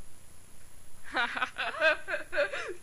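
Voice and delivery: drawn-out quiet laughter; long laugh. Acted. Laughs at the other person.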